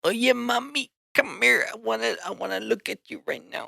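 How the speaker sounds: put-on high-pitched, coaxing Mexican paisa accent. Teasing his girlfriend, hammed-up Mexican paisa accent. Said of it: "when I s-, start talking like a".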